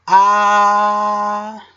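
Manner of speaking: ah sung out high and long, slowly; ah wysoko, przeciągle. Shown as a demonstration. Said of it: "would be pronounced".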